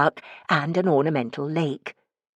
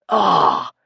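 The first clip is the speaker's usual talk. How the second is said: a low, sudden "Ah!" of realisation; calling out; 12 semitones lower, 3 dB louder than usual. A narrator's reading. Ricky suddenly grasping Morris's idea, surprised "Ah!". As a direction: catch on all at once. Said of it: "he called out".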